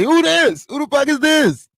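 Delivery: high-pitched, incredulous mock reply; mock bafflement. Replying to someone confessing undying love, mock bafflement. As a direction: act totally stumped by the caller.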